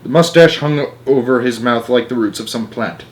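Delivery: plain reading voice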